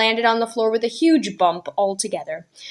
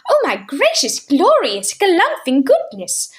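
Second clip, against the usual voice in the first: roaring laughter; 9 semitones higher, 4 dB louder than usual. Acted. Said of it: "roared Mister Wig".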